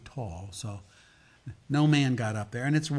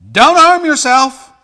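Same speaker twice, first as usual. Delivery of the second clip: high, drawn-out full-voiced shout; urgent shout; 22 dB louder, slower than usual. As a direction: cry out to stop him from afar. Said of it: "But Paul shouted".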